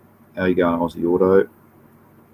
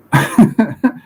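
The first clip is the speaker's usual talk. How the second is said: short laugh; 12 semitones higher, 4 dB louder than usual. Real, not acted. Laughs at sharing mishap.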